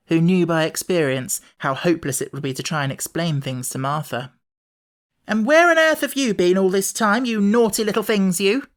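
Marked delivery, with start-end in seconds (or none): 5.3-8.7 high, loud scolding; scolding servant. A narrator's reading. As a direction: Martha tells off the naughty children. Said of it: "scolded by Martha".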